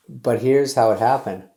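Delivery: ordinary talk, nothing marked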